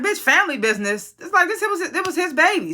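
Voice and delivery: incredulous. Real, not acted. Mocking a character's claim.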